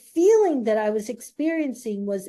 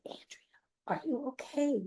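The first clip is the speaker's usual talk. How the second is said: hushed, concerned whisper; 2 semitones higher, 9 dB quieter than usual. Retold or imitated. Gail quietly asking Andrea if she is okay, whispered. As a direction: breathe the question gently, no voice. Classmate checks on her.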